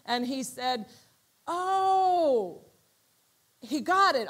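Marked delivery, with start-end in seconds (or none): 1.4-2.6 high, loud oh of discovery; light dawning; sudden realization. Retold or imitated.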